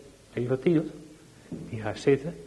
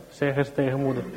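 usual speaking voice

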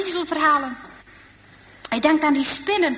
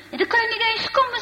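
high, quick protest; objecting; 8 semitones higher, faster than usual. Acted. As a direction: object in a hurry, voice pitched well up. Kees objects to Jan's plan.

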